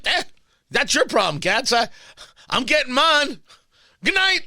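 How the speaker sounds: a hint of a shrugging Pete Davidson; Pete Davidson impression. Host doing a light Pete Davidson brushing off Katz, shrugging. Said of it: "That's my Pete Davidson impression".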